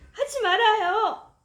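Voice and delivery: high, loud, slow scream of refusal; frightened girl screaming at the janitor. Acted. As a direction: scream at him to stop. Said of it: "내가 소리를 질렀어요".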